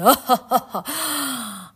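high, loud stage laugh; mocking ferryman laugh. A narrator's reading. Charon laughs at Orpheus's plea, loud laugh. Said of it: "Charon laughed".

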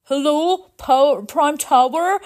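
high, loud phone greeting; anxious leader on phone. Acted. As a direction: answer the emergency call nervously. Leader answers emergency phone.

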